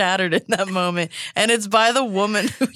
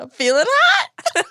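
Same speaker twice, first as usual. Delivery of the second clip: laughing. Pretend. Joking feverish reply.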